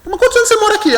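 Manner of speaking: very high, incredulous question; retold disbelief. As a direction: push the question way up in pitch, astonished. Voices his disbelieving follow-up question. (pergunta incrédula, bem aguda, recontada)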